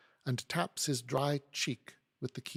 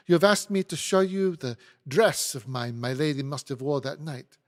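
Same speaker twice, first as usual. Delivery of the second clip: put-on louder, indignant French accent; 3 semitones higher, 7 dB louder than usual. Hortense says the lawyer used her, French accent. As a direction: accuse him forcefully in a French accent. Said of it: "apologize for my French accent".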